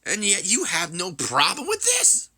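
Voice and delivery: high, incredulous demand; heated, rising question; indignant challenge. Acted. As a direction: push the pitch right up in disbelief. Acted indignant challenge to rival.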